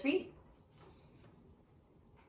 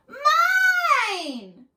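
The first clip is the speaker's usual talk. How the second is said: much higher, much louder monster yell; possessive cry; 8 dB louder than usual. Acted. Little monster Max shouting over his old toys. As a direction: shriek it like a greedy little monster. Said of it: "cried Max".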